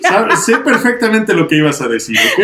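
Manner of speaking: teasing through laughter; laughing. Real, not acted. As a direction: chuckle it out, playful.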